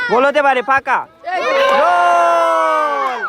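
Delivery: long stretched high goal cry; frantic football commentary. Real, not acted. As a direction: hold the goal call out at the top. Goal call, held long.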